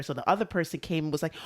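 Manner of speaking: ordinary talk, nothing marked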